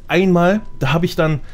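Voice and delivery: normal talking voice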